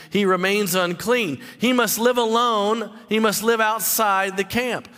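ordinary talk

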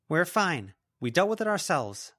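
high, firm brush-off; defensive assurance. Acted. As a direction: insist it is all handled. Lila brushes off the teacher.

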